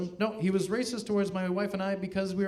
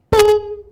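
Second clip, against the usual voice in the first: loud, high electronic-style boop; sustained mock intercom beep; imitated PA chime; 12 dB louder than usual. Comic imitating pool speaker before announcement, high loud beep.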